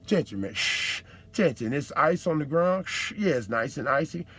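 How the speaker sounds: mock road sign voice. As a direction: play the warning sign like a smooth hustler. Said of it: "they hit you with a sign and say".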